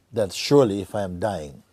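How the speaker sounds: quoting through laughter; laughing quote. Retold or imitated. Teacher retelling the man's plan, laughing. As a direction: let the laugh break into the words.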